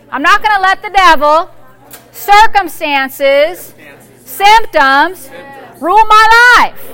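loud, rising preacher's declaration; preaching at full force. Acted. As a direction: declare it over the congregation with fire.

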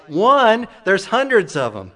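a driver who sees cars everywhere, loud and baffled; astonished old man. Retold or imitated. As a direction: exclaim high and loud, amazed. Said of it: "The old man replies".

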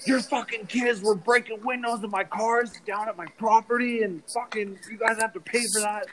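imitating a man yelling about his broken cars; angry neighbour. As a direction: play the furious car owner yelling at a mom. Angry neighbour yells at mom.

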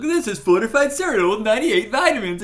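hammed-up cereal commercial announcer; cheesy ad announcer. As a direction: sell it like a cereal ad.

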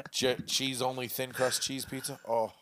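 ordinary talk, nothing marked

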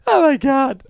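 high gasped oh my God; mock shock. Retelling a cartoon scene, mock horrified gasp. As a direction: act out the horror, high.

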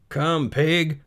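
high-pitched animal call; lilting farmer's pig call; coaxing farmer. Acted. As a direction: call the pig over invitingly.

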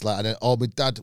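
ordinary delivery

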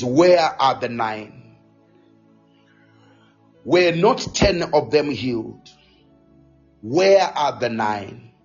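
lightly voiced low, deliberate questioning; quoting Jesus. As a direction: let each question land low and solemn. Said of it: "He says".

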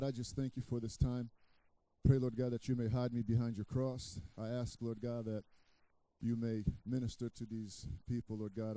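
usual speaking voice

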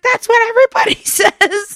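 high mock voice of the annoyed lady; annoyed old lady. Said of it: "She's like".

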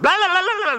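high, loud mock crowd babble. Pastor mimicking thousands of men shouting at once, loud babble. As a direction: imitate a roaring stadium mumble.

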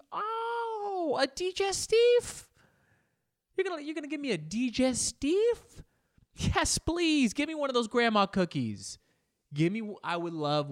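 high, loud mock-thrilled guest; hammed-up delight. Guest offered a digestif by the host, hammed-up excitement. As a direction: squeal with playful delight at the treat.

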